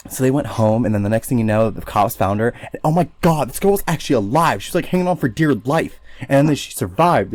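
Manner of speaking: lightly voiced valley girl. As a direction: tell it like a ditzy valley girl.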